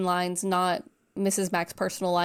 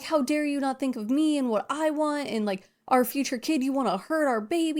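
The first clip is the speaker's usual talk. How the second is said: mock ranting Peter; 6 semitones higher than usual.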